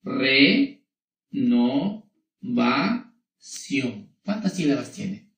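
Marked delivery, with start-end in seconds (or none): 0.0-4.1 Re-no-va-ción split into slow separate syllables. Shown as a demonstration. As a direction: stretch out Re-no-va-ción, one beat per syllable. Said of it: "Voy a subrayar".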